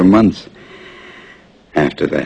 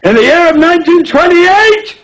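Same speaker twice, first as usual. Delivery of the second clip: high-pitched indignant shout; outraged full-voiced insistence; yelling; 4 dB louder than usual. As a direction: yell it insistently, far above your usual pitch. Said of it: "I was yelling at him".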